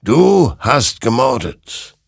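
slow, loud, high condemnation; accusing. A narrator's reading. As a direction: lean hard on each word. Creature accuses Hector of murder.

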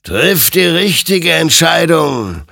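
slow, loud, high admonition; solemn, ringing exhortation; admonishing. A narrator's reading. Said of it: "ermahnte ihn sein Gegenüber".

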